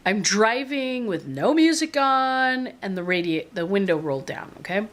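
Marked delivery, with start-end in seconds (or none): none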